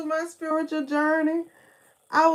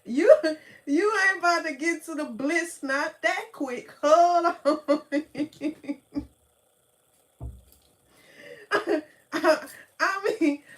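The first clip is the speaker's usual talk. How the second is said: laughing; 4 semitones higher, 6 dB louder than usual. Real, not acted. Laughing while telling.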